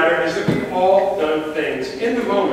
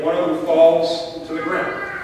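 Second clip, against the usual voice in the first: normal talking voice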